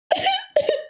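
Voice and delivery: quick, high real laugh; a laugh escaping; short laugh. Real, not acted. Retelling the coworker's reaction, quick real laugh. Teller cracks up at own story.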